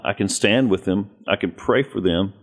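normal talking voice

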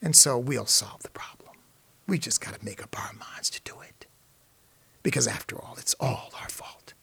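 hushed, conspiratorial whisper; hammed-up whispered confession; mock whisper. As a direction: drop to a whisper, as if confiding. Mock speech drops to whisper.

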